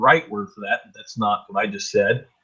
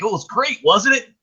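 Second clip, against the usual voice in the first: mock gushing fan; 8 semitones higher than usual. Mocking fans of the new album.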